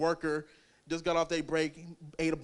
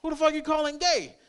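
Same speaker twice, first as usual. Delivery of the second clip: mock outrage; 10 semitones higher, 5 dB louder than usual. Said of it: "I'm like".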